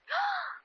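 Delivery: sharp high gasp of recognition; sudden startled intake of breath; shocked gasp. Acted. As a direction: gasp as you spot her. Pamela recognizes the impostor.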